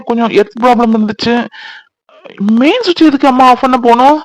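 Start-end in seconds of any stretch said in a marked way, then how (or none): none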